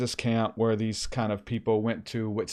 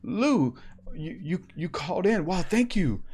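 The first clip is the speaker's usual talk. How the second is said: higher, starstruck gushing; excited kid; 6 semitones higher than usual. Retold or imitated. Retelling Levi thrilled the preacher called in, excited. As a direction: gush like a thrilled fan. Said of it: "he gets super excited".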